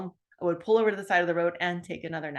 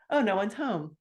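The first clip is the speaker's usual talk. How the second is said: normal talking voice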